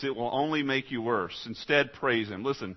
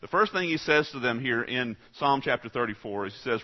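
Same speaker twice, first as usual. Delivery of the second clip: usual speaking voice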